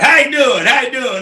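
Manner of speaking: mock-friendly recruiter saying hello; hearty greeting. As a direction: boom out a folksy hello.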